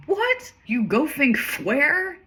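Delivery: high, slow, muffled words through food; astonished dad talking while chewing; mock mouth full. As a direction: try to shout a question round a mouthful of pie. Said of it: "'Cause he's got his mouth full".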